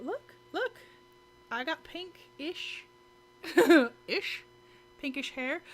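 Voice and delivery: high, bubbly show-and-tell; excited squeal. Real, not acted. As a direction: squeal it out, showing off new hair. Showing off new hair excitedly.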